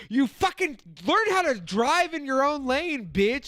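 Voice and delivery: mock angry commenter. An angry YouTube commenter yelling about lane driving, mocked high and loud. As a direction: ham up a furious hater yelling at you.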